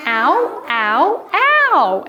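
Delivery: rising to a high, loud yelp; hurt letter O. Acted. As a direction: cries climbing into a squeal. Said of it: "Until letter O said".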